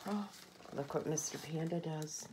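usual speaking voice

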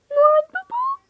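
very high, loud thrilled shriek; excited scream. Acted. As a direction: shriek with joy at Santa. Noel Baba'yı görüyor. (çığlık atarak heyecanla)

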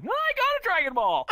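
high, loud jackass yell; mock scream. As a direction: scream it out like a boasting fool. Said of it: "not scream around like a jackass saying".

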